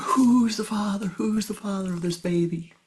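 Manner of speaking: mock angry interrogation; angry parents. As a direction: demand the answer from the daughter. Parents confront daughter.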